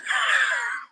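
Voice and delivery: high-pitched giggle; squeaky burst of laughter. Real, not acted. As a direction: laugh at your own silliness. Laughs after failed accent.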